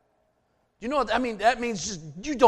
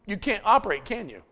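normal talking voice

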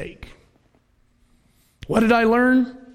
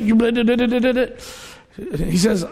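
ordinary talk, nothing marked

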